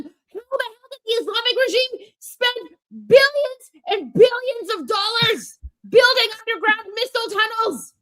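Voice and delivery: Armin rant impression. To the chat, Armin-style rant about sanctions. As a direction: rage at the woke leftists. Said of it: "let me go on a rant about the sanctions".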